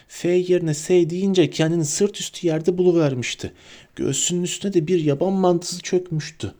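a small professor squeaking out his instructions; squeaky little professor. A narrator's reading. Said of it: "diye ciyakladı". (ciyaklayan küçük profesör sesi)

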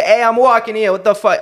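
put-on Toronto man's accent. Pedestrian snapping at someone in the way, Toronto man's accent. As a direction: yell it like a rude Toronto guy. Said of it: "with a fucking Toronto man's accent".

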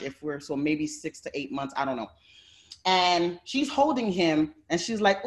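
ordinary talk

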